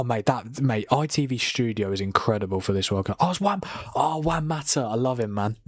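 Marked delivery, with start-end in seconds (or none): none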